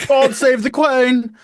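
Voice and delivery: high, loud, slow hammed-up British proclamation; mock British accent. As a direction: bellow it like a cartoon English patriot in a British accent. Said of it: "She screams in British".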